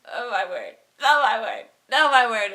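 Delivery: building from plain to a loud high cry; rising panic. Retold or imitated. Narrator staring at the men's shoes, building to a loud cry. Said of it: "I'm like".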